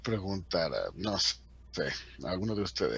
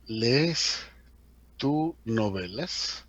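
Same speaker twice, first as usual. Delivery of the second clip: slow inverted question; 2 semitones higher, much slower than usual. Shown as a demonstration. Teacher models formal inverted word order. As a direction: read the inverted question slowly. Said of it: "así como que".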